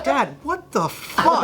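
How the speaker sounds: laughing disbelief. Real, not acted. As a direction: laugh through the words in disbelief. Disbelief at his dad.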